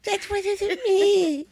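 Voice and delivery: very high, slow, small voice; "I'm sorry" voice. Acted. Denying it to the co-host in a high, tiny apologetic voice. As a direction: squeak it softly, drawn out. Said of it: "Is that your "I'm sorry" voice?".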